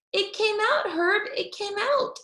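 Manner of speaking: put-on high, excited little girl voice; little girl bursting with news; Junie B excited. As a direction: burst out the news like a thrilled kid. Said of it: "I said".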